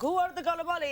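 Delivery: high-pitched imitation of a busboy; oblivious busboy. Busboy interrupting a proposal, obliviously calling out. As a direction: call out like an interrupting server.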